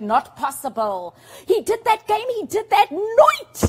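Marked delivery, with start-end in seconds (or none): 1.5-3.7 high, loud stunned disbelief. Shown as a demonstration. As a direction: cry out you can't believe it. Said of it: "I can't believe it".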